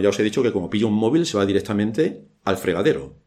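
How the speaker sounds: ordinary delivery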